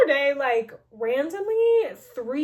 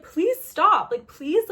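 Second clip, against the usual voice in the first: much higher, louder pleading; 8 semitones higher, 8 dB louder, slower than usual. Retold or imitated. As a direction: beg him to stop. Begging Van to stop.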